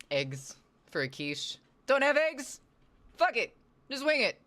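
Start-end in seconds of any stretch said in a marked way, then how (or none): 1.9-4.4 exclaiming. Pretend. Mock exasperated cooking advice.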